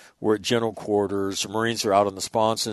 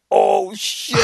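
higher-pitched mock cry of alarm; alarmed exclamation; 8 semitones higher than usual. Imitating marines caught with the picture, alarmed cry. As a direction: blurt it like someone just caught. Marines realise officer may know.

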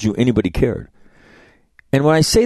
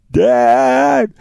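put-on high, drawn-out teen "Dad."; whiny daughter; 5 dB louder than usual. Daughter groaning at her dad's joke, high and whiny. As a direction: imitate a teen girl protesting her father, pitched up. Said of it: "And she's like".

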